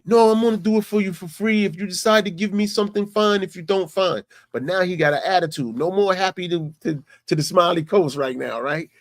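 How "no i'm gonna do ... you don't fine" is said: upset hustler. Retold or imitated. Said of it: "he gets all upset".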